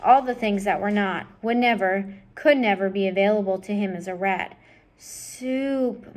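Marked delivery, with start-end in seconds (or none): 5.0-6.1 soft, high, longing moan. Acted. Roscuro remembering soup, soft longing moan. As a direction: ache over the word. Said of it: "moaned Roscuro".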